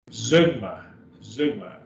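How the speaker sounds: zeugma said ZOOG-mah, two syllables; pronounced zeugma. Shown as a demonstration. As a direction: model zeugma for students to repeat. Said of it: "And it's pronounced zeugma".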